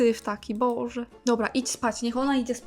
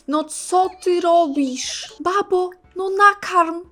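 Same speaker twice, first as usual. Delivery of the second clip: high, loud and slow, fed-up; exasperated yell; 7 semitones higher, 6 dB louder, slower than usual. Real, not acted. Player yelling at her Sim to feed the baby. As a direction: drag it out, losing patience.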